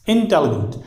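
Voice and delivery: "इंटेलिजेंट" as in-TEL-jent, silent i; three-syllable intelligent. Shown as a demonstration. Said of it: "आई जो है silent होकर". ("इंटेलिजेंट" तीन syllable में, इन-टेल-जेंट)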